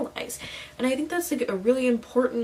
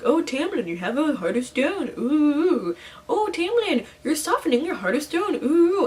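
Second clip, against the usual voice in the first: high, louder hammed-up sing-song with swooping oohs; mock melodrama with a cooing ooh; mock dramatic swoon; 5 semitones higher, 4 dB louder than usual. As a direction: tease like an overwrought romance heroine. Mocking the book's running joke.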